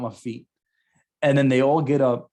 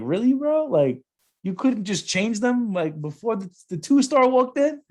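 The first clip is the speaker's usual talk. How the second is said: teasing disbelief; 10 semitones higher than usual. Retold or imitated. Colleagues mocking him.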